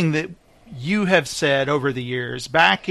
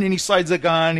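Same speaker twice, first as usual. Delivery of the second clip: normal talking voice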